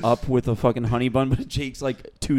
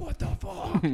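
high, soft shocked squeak; tiny appalled cartoon voice; horrified food; 10 semitones higher, 9 dB quieter, slower than usual. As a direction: squeak it in mock horror. Other foods react in horror.

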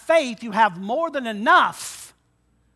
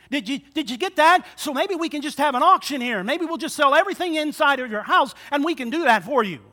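mock pushy helper; 6 semitones higher, 5 dB louder than usual. Mock Elisha offering auction.

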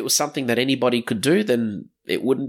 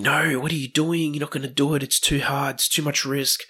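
higher-pitched voice of doubting parents; discouraging loved ones; 5 semitones higher than usual. Acted. Parents or partner objecting to the big plan, discouraging. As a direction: imitate worried naysayers, pitched up. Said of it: "by them saying".

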